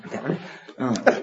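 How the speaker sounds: ordinary talk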